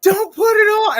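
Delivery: very high, drawn-out mock scream; imitated scream. Retelling a young man at the match, high imitated scream. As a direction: wail it like a desperate young fan. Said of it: "he was screaming like".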